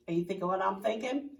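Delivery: usual speaking voice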